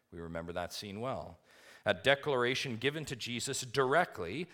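ordinary talk

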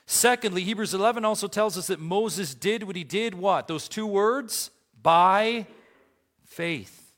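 high, loud pulpit delivery; full-force preaching. Acted. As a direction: drive each point out to the congregation.

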